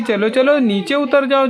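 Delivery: ordinary talk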